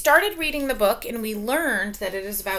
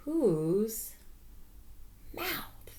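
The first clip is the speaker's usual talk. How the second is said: secretive whispered question; 13 dB quieter, slower than usual. Acted. Teacher teasing the mystery animal, whispered.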